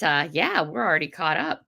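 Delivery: usual speaking voice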